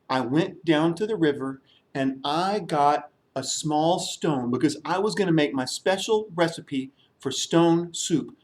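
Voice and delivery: ordinary delivery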